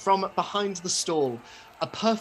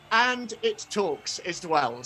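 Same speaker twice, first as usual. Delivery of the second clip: ordinary delivery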